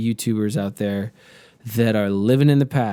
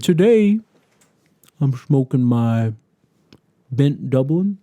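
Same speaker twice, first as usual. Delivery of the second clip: mock slow YouTuber; slower than usual. Pipe YouTuber introducing his pipe, mock slow. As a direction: parody a dawdling pipe reviewer. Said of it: "they're doing it so slowly".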